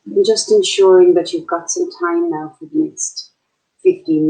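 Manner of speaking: unhurried meditation guide, long pauses between phrases; short, spaced-out calming instructions; guided meditation. Acted. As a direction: guide listeners gently into their breath.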